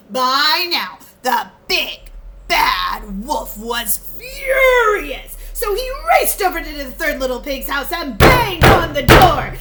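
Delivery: loud, fast dramatic narration; excited narration. A narrator's reading. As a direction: tell it with rising urgency. Narrator builds wolf's fury.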